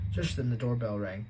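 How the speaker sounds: ordinary delivery, nothing marked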